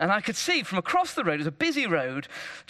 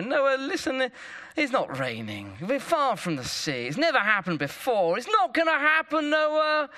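lightly acted exasperated sceptic, rising at the end; mock pleading that climbs higher toward the end; reasoning with someone; 6 semitones higher than usual. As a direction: reason with him, growing more insistent. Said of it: "tried to reason with Noah".

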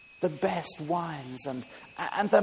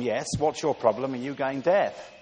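louder, impatient retort; exasperated wife; 5 dB louder than usual. Acted. Wife replying for the third time to her husband, exasperated. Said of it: "she replied for the third time".

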